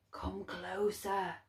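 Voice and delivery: slow hushed beckoning from the dark. A narrator's reading. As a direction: coax him near, slow and cold. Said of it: "The voice of the dark was as creaky".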